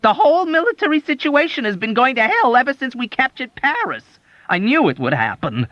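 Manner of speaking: high, loud grumbling rant; sulking ire. A narrator's reading. Doctor complaining sulkily to the soldier. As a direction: rant in sulky outrage. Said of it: "his sulking ire".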